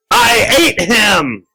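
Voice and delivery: high, loud, drawn-out monster boast; gloating creature. A narrator's reading. Creature taunts the child about the neighbor, gloating loudly. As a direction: stretch each word out as a monster. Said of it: "the creature laughed".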